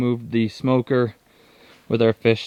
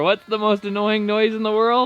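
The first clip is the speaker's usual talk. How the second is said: Dumb and Dumber quote; 11 semitones higher than usual. Retold or imitated. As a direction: ask it like a dim-witted goof. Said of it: "like that Dumb and Dumber".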